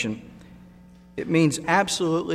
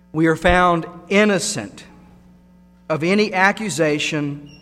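ordinary talk, nothing marked

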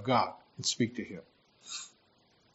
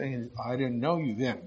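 ordinary talk

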